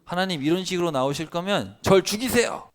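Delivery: high, loud defiant demand; defiant Jonah. Retold or imitated. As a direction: hurl it at God in a sulk. (하나님께 높고 크게 대드는 요나)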